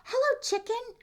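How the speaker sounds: high, loud chirpy duck greeting; cheery duck. Acted. Duck greets the cow, high and loud. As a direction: sing out hello like a happy duck.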